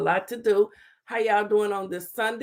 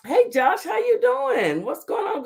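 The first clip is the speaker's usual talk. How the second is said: excited greeting; 11 semitones higher than usual. Real, not acted. Greeting first viewer warmly.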